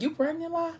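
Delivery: jumps way up high in disbelief; mock stunned gasp of a question; shocked question. Intruder sees her belly in the light, shocked high question. Man sees she is pregnant.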